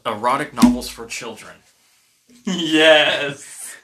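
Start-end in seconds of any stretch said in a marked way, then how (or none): 2.4-3.8 loud, triumphant yes with a laugh in it; excited cheer. Real, not acted. Player cheering a card just read out, excited.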